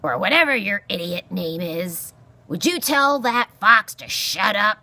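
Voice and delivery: loud, exasperated porcupine; cantankerous cry. A narrator's reading. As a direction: demand it loudly, rising in pitch. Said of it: "Aerith cried".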